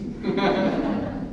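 breathy unvoiced chuckle; a short airless laugh of disbelief; wife's laugh. Wife laughing at husband's sudden love text, airless chuckle.

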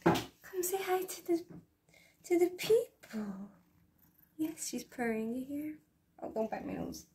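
soft, hushed coaxing, partly whispered. Real, not acted. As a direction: gently coax her pet to greet. Cooing to her cat.

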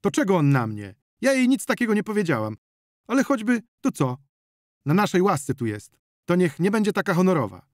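high, loud quarrelling woman; heated self-defence; indignant protest. A narrator's reading. Zonia quarrels back at Wasil.

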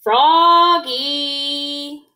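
high, loud sing-song call. A narrator's reading. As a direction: stretch the name to summon a child. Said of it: "called his mother".

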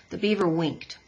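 plain reading voice

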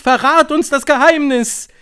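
high, loud voice of an eager crowd; high, urgent witches' plea; impatient crowd. A narrator's reading. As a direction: call out impatiently for the secret. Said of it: "rief die Menge ungeduldig".

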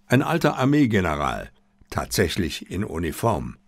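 plain reading voice

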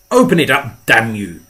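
shouted. A narrator's reading. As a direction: explode at him, all patience gone. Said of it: "he shouted".

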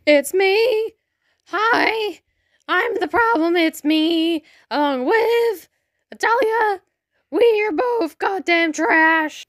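sing-song self-roast; mock self-deprecating. Joking self-insult after villain bit.

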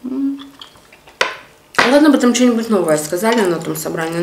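speaking with food in mouth; mumbled between bites; talking while chewing. Real, not acted. Seen 3: bite taken at -2.0s; she talks with food still in mouth.

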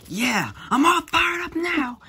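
high, loud fiery shout; Natsu battle cry. Acted. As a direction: roar it like an anime hero.

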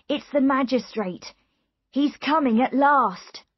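high, loud, relieved announcement; excited. A narrator's reading. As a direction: announce the arrival excitedly, stretching the end. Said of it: "said Richards excitedly".

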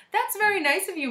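high, polite gentleman; put-on courteous voice; pleasant stolen voice. A narrator's reading. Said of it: "in the pleasant voice he stole".

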